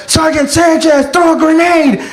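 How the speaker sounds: high battlefield holler; calling out orders. Acted. Soldier calling to his sergeant in a firefight, urgent holler. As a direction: yell it urgently across a firefight. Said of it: "somebody calling me to throw a grenade".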